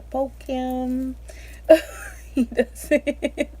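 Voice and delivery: laughing at her own embarrassment. Real, not acted. Mom-to-be laughing while telling viewers about her baby.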